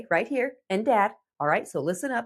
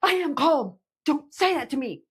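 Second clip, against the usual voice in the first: put-on teen snapping back; 5 semitones higher than usual. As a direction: fire back like an offended teen.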